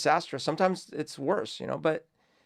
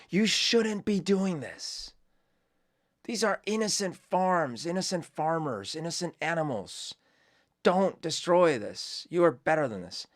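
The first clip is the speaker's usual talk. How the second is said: pleading with a storm; 5 semitones higher than usual. Pretend. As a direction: beg the storm like it could listen. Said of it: "this is kinda like yelling at a hurricane".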